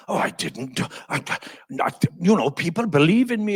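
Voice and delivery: put-on stammering Welsh accent; imitated flustered Welshman; Neil Kinnock. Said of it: "in his Welsh accent".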